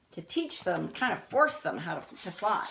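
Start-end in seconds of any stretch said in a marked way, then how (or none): none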